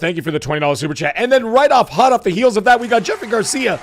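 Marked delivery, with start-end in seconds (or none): 1.2-3.8 high, loud hyped shout-out; voice cracking. Real, not acted. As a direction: announce it big on a cracking voice. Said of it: "As my voice continues to crack".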